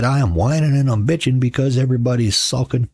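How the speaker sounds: hammed-up complaint; retold comeback. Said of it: "And I said".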